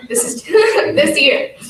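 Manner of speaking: slow, tearful laugh; laughing through tears. Real, not acted. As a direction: choke up and laugh it off. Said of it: "second time I've cried".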